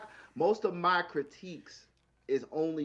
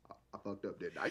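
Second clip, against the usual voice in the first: low, much softer, laughing admission; sheepish confession; 9 semitones lower, 11 dB quieter, faster than usual. Retold or imitated. Man admitting his mistake to the cop, low sheepish laugh. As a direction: own up with a guilty chuckle.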